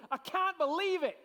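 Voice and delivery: high, loud stunned exclamation; disbelief. Shown as a demonstration. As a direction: act out Zechariah's disbelief. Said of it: "who when he first heard it, he said".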